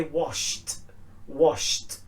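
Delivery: "washed" ending in a voiceless t. Shown as a demonstration. Teacher modelling S H verbs, T ending. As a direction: say "washed" as one syllable, "washt". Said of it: "it's washed with a t".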